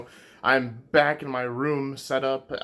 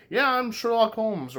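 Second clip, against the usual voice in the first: mock English accent; 8 semitones higher, slower than usual. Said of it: "the English accent that I'm not gonna".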